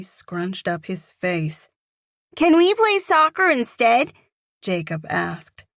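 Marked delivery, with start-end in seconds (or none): 2.3-4.1 put-on high, loud child's question; hopeful little boy. A narrator's reading. Boy asking the girl to play soccer, high and hopeful. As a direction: ask hopefully like a small boy.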